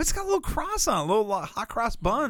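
high, fast mock-cute description. Teasing his friend about the wafer, high and quick. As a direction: pitch it way up to mock the bread.

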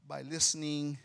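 ordinary delivery

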